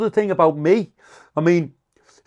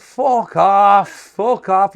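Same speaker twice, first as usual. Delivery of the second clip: loud dismissal; 4 semitones higher, 8 dB louder, much slower than usual. Retold or imitated. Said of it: "I said".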